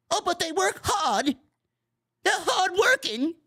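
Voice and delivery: high, slow, sarcastic singsong; mocking whiny voice. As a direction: whine it out like a naive fan. Mocking defenders of the streamers.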